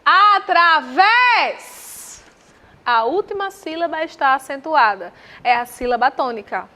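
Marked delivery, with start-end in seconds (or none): 0.1-2.2 slow, loud, high call stretching the last syllable of "Através"; stressed syllable called out. Shown as a demonstration. Teacher calls "Através" to show final stress, drawn-out "vés".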